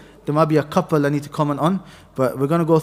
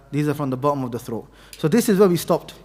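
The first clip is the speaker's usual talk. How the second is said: normal talking voice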